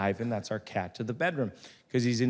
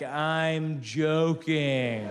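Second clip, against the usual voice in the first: very slow, stretched-out delivery; drawn-out excuse; 2 semitones higher, much slower than usual. Retold or imitated. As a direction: stretch it out as a lame defence. Idiot's defence, drawn out.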